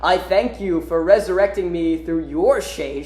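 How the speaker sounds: hammed-up sinister thanks; resurrected villain. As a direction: start on a big laugh, then savour the words. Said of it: "rises the guy who took Silver and Blaze".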